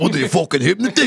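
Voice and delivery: tough New Jersey guy voice; Jersey accent. Pretend. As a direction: say it like a wiseguy from Jersey. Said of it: "you should have said it in Jersey accent".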